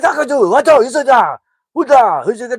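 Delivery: high, very loud mock-Korean gibberish; aggressive GPS voice. GPS freaking out at the family in Korean, aggressive. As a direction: rant like a berserk navigation voice. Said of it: "the most aggressive voice on the G P S".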